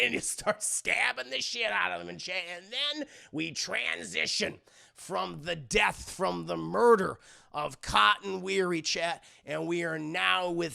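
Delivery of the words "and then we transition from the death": ordinary talk, nothing marked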